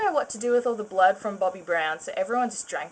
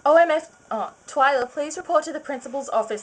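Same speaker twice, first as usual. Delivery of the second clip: louder, higher teacher summons; teacher's call; 6 semitones higher, 5 dB louder than usual. Acted. Teacher summons Twyla to the principal's office, loud high call. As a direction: call her out like a shocked teacher.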